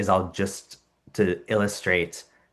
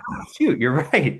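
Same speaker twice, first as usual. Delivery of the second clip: very high surprised cry with a laugh; caught-out kids; 8 semitones higher, 4 dB louder than usual. Retold or imitated. Students admitting they believed in the shoe, high surprised laughing cry. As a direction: realize you've been caught out. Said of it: "And they're like".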